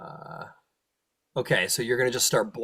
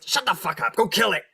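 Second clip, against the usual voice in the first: angry devil command; 8 semitones higher than usual. Acted. Devils order him again.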